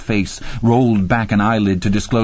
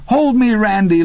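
screaming; 11 semitones higher than usual. A narrator's reading. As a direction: scream for him to hold her. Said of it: "Laverne screamed".

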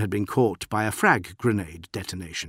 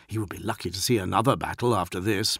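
ordinary delivery

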